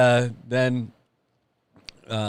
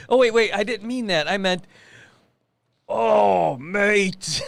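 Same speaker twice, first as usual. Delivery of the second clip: high-pitched imitation of a botched Australian accent; bad Australian accent; 9 semitones higher, 4 dB louder, slower than usual. Son correcting his French attempt, bad Australian accent. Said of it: "it always started off with".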